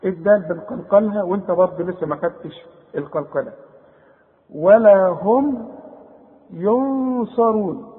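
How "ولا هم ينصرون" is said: slow, drawn-out Quran recitation with a nasal hum on the nun. Shown as a demonstration. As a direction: chant it slowly, holding the ghunna. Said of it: "بعدها صاد، ... يبقى حغن وحخفي". (تلاوة بطيئة مع الغنة)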